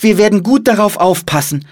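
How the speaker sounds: put-on high, loud boy's voice; earnest promise. A narrator's reading. Boy promises monk to guard the book, earnest. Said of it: "versprach Philipp".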